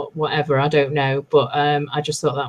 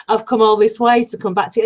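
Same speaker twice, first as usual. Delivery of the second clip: mock exasperated Jorah; 6 semitones higher, 3 dB louder, faster than usual. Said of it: "He must have just thought".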